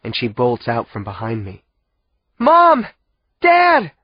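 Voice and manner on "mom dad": high, loud desperate cry. A narrator's reading. Girl calling to her parents in the dark, loud cry. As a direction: call out for your parents. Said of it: "she cries".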